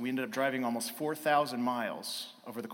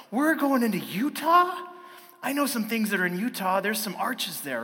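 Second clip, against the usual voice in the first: put-on high, loud, amazed child's questioning, easing slightly; excited kid; 7 semitones higher, 5 dB louder than usual. Son spots Utah on the road trip, excited. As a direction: blurt it out like a kid making a discovery.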